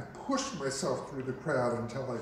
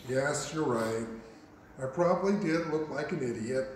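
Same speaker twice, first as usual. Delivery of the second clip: usual speaking voice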